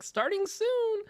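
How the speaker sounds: high sing-song. Retold or imitated. Quoting the stream's waiting screen.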